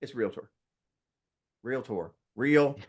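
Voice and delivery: "Realtor" as REEL-tor, repeated with spaces between; correct two-syllable pronunciation. Shown as a demonstration. Trainer teaching the right word, deliberate two-syllable repetition. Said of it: "Two syllables".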